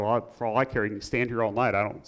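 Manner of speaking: muttered under breath. Retold or imitated. Shop man giving up on the boy, muttering under his breath. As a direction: mutter it dismissively, as if walking away. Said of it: "He kinda muttered under his breath".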